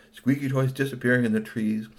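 ordinary delivery

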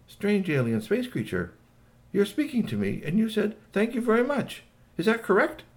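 high, wondering alien voice; Igglypop. Acted. Alien asks Shui if he understood, high and wondering. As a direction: ask in careful, high-pitched amazement. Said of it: "Igglypop stopped and said".

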